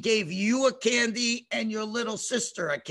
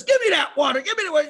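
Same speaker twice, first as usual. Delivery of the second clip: loud, raised demanding voice; grabbing Egyptian; 5 semitones higher, 5 dB louder, much faster than usual. Acted. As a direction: demand it, higher and louder. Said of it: "when the Egyptian grabs the water".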